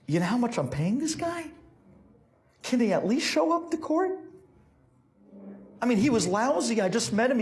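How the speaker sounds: high, incredulous complaining; acted exasperated client; indignant protest. Client protests the Rebbe's strange blessing.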